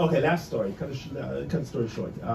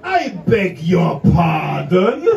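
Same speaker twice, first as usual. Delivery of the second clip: louder, very slow and careful; deliberate I beg your pardon; 8 dB louder, much slower than usual. Retold or imitated. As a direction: spell it out word by word, proudly. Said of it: "she said".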